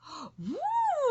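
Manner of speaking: sharp high intake of breath; high gasp of wonder. Real, not acted. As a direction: gasp in awe. Gasp at the art.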